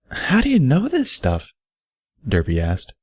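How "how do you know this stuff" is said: put-on high, far-off girlish pony voice, anxious; an anxious young mare asking, pitched way up; Derpy's voice. Derpy asking Barry in the bath, high and anxious.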